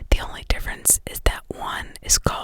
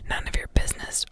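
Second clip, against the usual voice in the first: usual speaking voice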